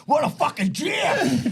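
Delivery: high, slow, ecstatic yell; excited shout. Retold or imitated. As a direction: belt it like the song just made his night. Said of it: "Danny's like".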